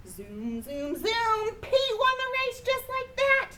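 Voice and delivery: high, bright-voiced zooming win; excited race call. A narrator's reading. Pete wins the race, excited high call. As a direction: cheer it like crossing the finish line.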